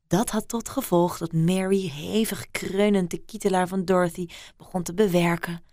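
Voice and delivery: ordinary delivery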